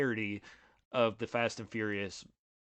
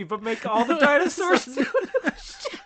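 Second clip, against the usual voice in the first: laughing; 14 semitones higher, 9 dB louder, slower than usual. Real, not acted. Laughing through absurd ideas.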